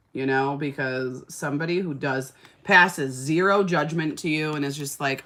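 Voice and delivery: ordinary delivery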